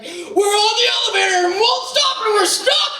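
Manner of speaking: high, loud panicked scream; freaking out. Retold or imitated. Caller trapped in the elevator to the hotel, freaking-out scream. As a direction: shriek it into the phone in panic. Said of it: "I'm freaking out".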